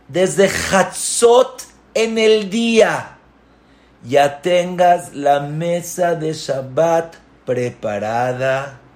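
slow, loud, rising emphatic list; solemn slow conditions. Acted. Sage setting conditions for the mother, slow and emphatic. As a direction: lay out each condition deliberately.

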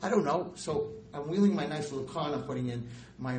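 ordinary delivery

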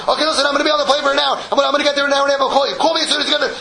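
high, loud, rushed orders; frantic businessman. Retold or imitated. Businessman frantically tells his secretary, high and loud. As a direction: bark it out high like a man in a panic.

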